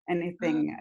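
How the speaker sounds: usual speaking voice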